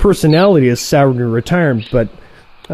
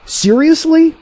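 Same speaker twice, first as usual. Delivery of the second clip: a touch of disbelief, pitched up; a mildly stunned single word; incredulous; 11 semitones higher, slower than usual. Acted. Martin in mild disbelief at his old friend.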